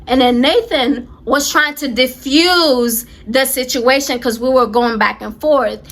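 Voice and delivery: ordinary delivery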